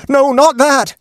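panicked high-pitched scream of refusal; terrified scream. A narrator's reading. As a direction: shriek it out as if burned by a ghost's touch. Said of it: "it rose to a scream as it spoke".

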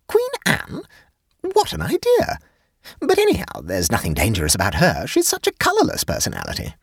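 very high, astonished woman's voice; high-pitched indignant surprise; incredulous. A narrator's reading. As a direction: echo "Queen Anne" in high disbelief, then protest. Jane echoes the answer in disbelief.

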